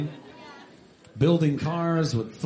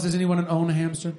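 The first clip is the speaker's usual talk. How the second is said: usual speaking voice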